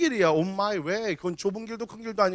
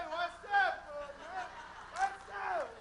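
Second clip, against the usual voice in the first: much higher, softer, airy "What's up"; casual street greeting; 11 semitones higher, 14 dB quieter, much slower than usual. Retold or imitated. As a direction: toss it off lightly while strolling over. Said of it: "걸음걸이는 또 얼마나 재밌어".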